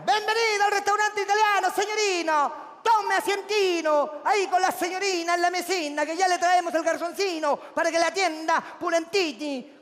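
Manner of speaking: high, hammed-up mock Italian waiter; fake Italian accent. A waiter greeting the couple in hammed-up mock Italian. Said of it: "por qué habláis como a hueonini".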